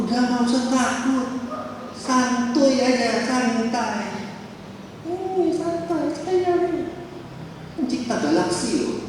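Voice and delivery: relaxed Jesus. Acted. Jesus voiced, casual and calm.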